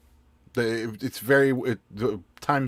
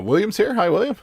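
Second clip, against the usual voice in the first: bright, lifted hello, higher and louder; excited greeting; 8 dB louder than usual. Real, not acted. Host greeting a viewer, higher and louder. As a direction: welcome him in with a lift.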